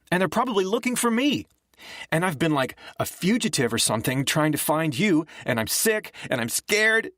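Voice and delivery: high, tearful, furious rant; scared girl lashing out at Nick, crying and furious. A narrator's reading. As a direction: let the fear and rage spill out through tears. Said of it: "but she was also furious".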